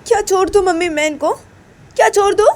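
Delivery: put-on high, loud protesting voice of a young wife; wife refusing to leave her husband, high and indignant; pleading wife. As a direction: cry out to her mother, pitched high in disbelief. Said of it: "तो तभी माँ बोलती कि".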